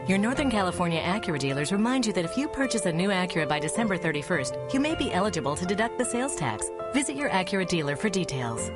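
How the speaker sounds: fast legal disclaimer. Acted. Rushed dealer fine print.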